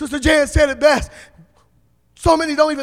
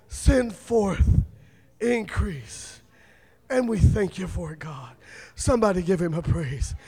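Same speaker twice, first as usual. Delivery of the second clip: hushed prayer; 4 dB quieter, slower than usual. Real, not acted. Preacher softly praying increase over a man. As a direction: breathe the blessing quietly.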